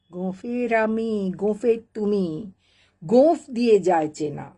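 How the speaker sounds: lively, rhythmic Bengali verse reading; comic poem recitation. Acted. Reader reciting a famous poem to listeners, animated comic recitation. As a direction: perform the nonsense verse with theatrical swings.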